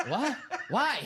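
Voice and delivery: high, loud, laughing outburst of disbelief; mock outraged yelp. Imitating a host yelling at a silly caller, laughing. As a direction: squeal it, cracking up. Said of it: "he was like".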